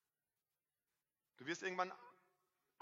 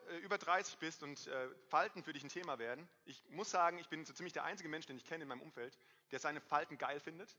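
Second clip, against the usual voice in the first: normal talking voice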